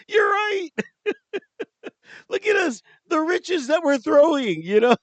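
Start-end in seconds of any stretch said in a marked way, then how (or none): 0.1-4.0 high laughing yell; mock shout. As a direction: cry it out in giddy agreement. Retold exclamation, shout heard.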